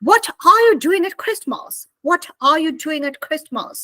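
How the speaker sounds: British what with crisp t; British-style Christmas question; British accent. Shown as a demonstration. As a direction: drop the r in are, British style. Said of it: "So the British people say".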